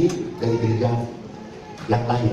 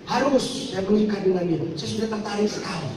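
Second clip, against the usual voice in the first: high, pleading and fast; hammed-up stubborn girl insisting; insistent young woman; 8 semitones higher, 3 dB quieter than usual. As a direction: refuse him, she must marry. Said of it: "kata saya".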